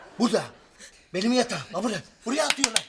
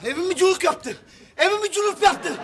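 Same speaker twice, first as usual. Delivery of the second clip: angry rant; 6 semitones higher, 5 dB louder than usual. Acted. Raging about his house.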